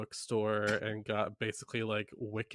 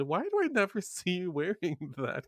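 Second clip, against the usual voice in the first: curious mom voice; 6 semitones higher than usual. Retold or imitated. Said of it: "my mom one day said".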